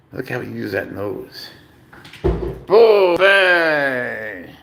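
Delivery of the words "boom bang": excited exclamation. Real, not acted. Cat does trick with nose.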